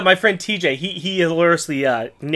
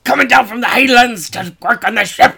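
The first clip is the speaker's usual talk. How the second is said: high, loud Highland alien voice; hammed-up Scottish creature, pitched high; Scottish Snark; 9 semitones higher, 8 dB louder than usual. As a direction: belt it as a Scottish monster. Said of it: "only snark with a full beard and a kilt".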